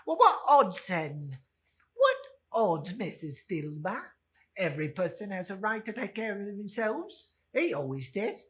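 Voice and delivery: loud, brassy woman daring them; defiant charwoman. Acted. Charwoman justifying the theft to the others, loud and bold. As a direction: throw your defiance at them.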